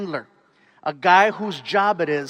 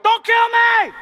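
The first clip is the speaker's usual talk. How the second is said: very high, loud plea; mock panicked scream; 7 dB louder, slower than usual. Drivers pulled over by police, mock panicked scream. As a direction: shriek it like you fear for your life.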